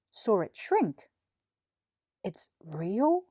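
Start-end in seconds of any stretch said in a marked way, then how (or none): 2.2-3.2 astonished. Acted. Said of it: "asked in astonishment".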